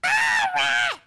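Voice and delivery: high-pitched shriek of fright; startled scream. Real, not acted. Seeker suddenly seeing a rat, shrieking. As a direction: shout it high in sudden alarm.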